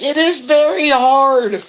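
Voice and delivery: high, slow, loud whiny protest; frustrated classmate. Retold or imitated. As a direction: drag it out as an exasperated girl. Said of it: "She's like".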